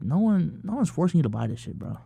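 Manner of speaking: normal talking voice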